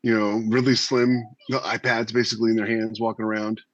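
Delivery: ordinary talk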